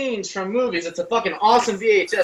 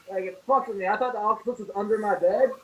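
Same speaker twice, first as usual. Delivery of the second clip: ordinary delivery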